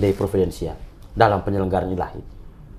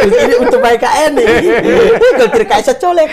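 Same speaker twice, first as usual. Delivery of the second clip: high, loud words through laughter; real laughing fit breaking into the speech; 13 semitones higher, 8 dB louder than usual. Real, not acted. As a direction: joke while cracking up.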